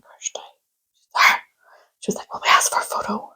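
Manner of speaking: hushed, voiceless undertone; secretive whisper. Retold or imitated. Friends whispering about asking drummer for photo, secretive whisper. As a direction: whisper so the person nearby can't hear.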